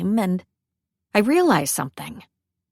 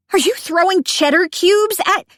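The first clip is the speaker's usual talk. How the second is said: high, louder disbelief; shocked yelp; 10 semitones higher, 5 dB louder than usual. Acted. Elsie pelted with cheese by Cece, high shocked protest. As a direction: cry out while being pelted.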